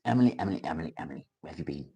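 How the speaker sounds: quiet, playful chiding; hushed mock reproach. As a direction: drop right down after the outburst, almost under your breath. Repeats the question after shouting it.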